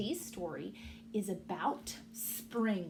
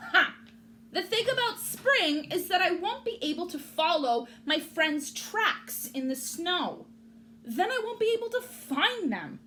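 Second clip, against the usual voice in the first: grumpy rabbit; 9 semitones higher, 6 dB louder than usual. Acted. Rabbit complaining.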